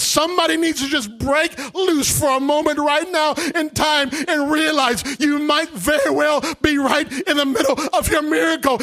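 shouted, sung-out preaching over music; preaching at full force. Acted. Preacher urging the church to worship, full preaching. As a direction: lift the crowd, half singing.

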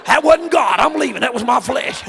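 a hammed-up offended churchgoer; mock indignant shout. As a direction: shout it like you're walking out of church. Quoting a worshipper storming off.

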